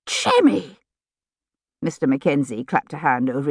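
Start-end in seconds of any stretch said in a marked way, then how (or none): 0.1-0.8 sharp high cry of reproof; scandalised parent. A narrator's reading. Parent stops outspoken son before the soldier, high scandalised cry. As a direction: cut the child off, pitched right up.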